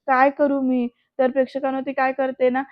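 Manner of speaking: ordinary talk, nothing marked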